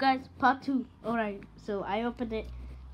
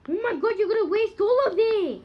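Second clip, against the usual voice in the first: excited; 11 semitones higher, 8 dB louder than usual. Real, not acted. Alarmed at wasted candy.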